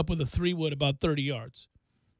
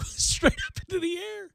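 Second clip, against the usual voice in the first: high-pitched, laughing through the words; 12 semitones higher than usual. Real, not acted. Radio host reliving a pro's pop-up, laughing high. Laughing over the popped-up shot.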